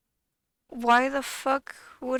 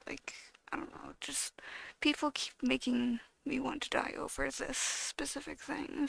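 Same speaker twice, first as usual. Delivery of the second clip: much softer, breathy whisper with no voice; real whisper; 7 dB quieter than usual. Real, not acted. Vlogger admitting the comments make her want to die, whispered. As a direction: let the voice drop to a tired whisper. Drops voice mid rant.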